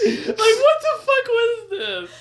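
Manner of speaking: high, loud, slow laughing outburst; laughing disbelief. Real, not acted. Co-host reacting to the absurd promo, cracking up in disbelief. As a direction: wail it through helpless laughter.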